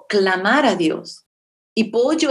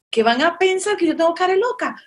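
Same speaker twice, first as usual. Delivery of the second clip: mock indignation; 8 semitones higher than usual. Mock outrage at misread name.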